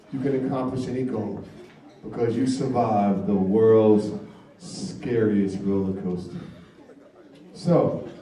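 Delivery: low, soft hypnotist voice; hypnotic suggestion. Acted.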